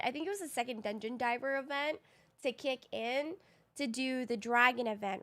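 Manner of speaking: ordinary delivery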